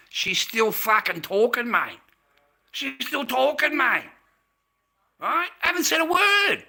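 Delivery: high-pitched, incredulous yell; exasperated outburst. Retold or imitated. Telling the boys she kept talking on the phone, exasperated outburst.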